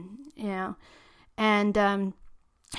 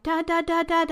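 urgent, higher repeated calls; panicked calling for dad; 8 semitones higher than usual. Retold or imitated. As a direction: call out to him in alarm. Panicked, seeing boots at window.

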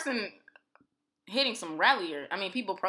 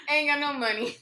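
louder and higher, laughing; emphatic; 7 semitones higher, 5 dB louder than usual. Real, not acted. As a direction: say it again, punching it out. Repeated louder after a laugh.

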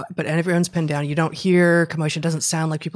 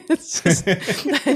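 laughing; 9 semitones higher, 3 dB louder, much slower than usual. Real, not acted. Laughing at the vat image.

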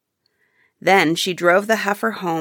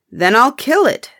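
higher and louder, decided; firm declaration; 6 semitones higher, 5 dB louder than usual. Acted. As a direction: announce it flatly and firmly. Old man agrees to kill heifer.

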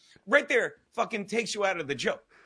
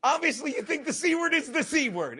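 higher pitched; 7 semitones higher than usual. Real, not acted. Correcting to avoid a swear.

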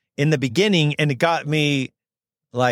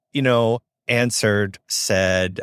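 normal talking voice

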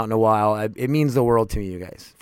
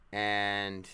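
ordinary delivery

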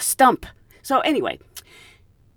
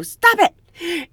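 mom voice; 10 semitones higher, 5 dB louder than usual. Retold or imitated. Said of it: "mom voice, dad voice".

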